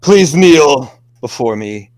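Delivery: bestow the gift like a solemn lord; grandly granting a wish. Acted. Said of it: "a hand reaches out ... towards you".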